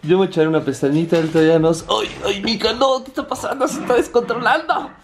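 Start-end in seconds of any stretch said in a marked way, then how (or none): 1.9-4.9 high, laughing mock alarm; playful mock panic. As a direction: squeal in fake panic.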